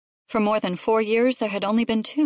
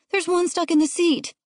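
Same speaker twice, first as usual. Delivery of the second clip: put-on high-pitched kid alerting Mom; anxious little boy's voice; urgent child; 10 semitones higher, 3 dB louder than usual. A narrator's reading. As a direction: point it out like a worried child. Child points out stuck tadpole.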